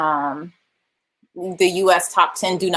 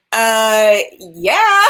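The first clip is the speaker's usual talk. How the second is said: mock-obvious yes, pitched up and loud; eager acceptance; 5 dB louder than usual. Acting eager yes to an offer.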